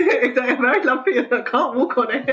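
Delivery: laughing. Real, not acted. Echoing host's joke, laughing.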